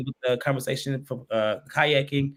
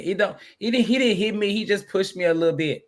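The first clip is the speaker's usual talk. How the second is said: higher-pitched mock excuse-making voice; making excuses for him; 6 semitones higher than usual. Co-host mimicking a woman excusing her man, mock pleading. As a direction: imitate someone defending their partner, pitched up. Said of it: "That sound like somebody saying".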